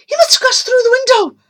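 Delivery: high, loud and fast; panicked rush. Acted. Amy fearing the boy climbed out, fast and panicked. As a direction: rush it out in alarm.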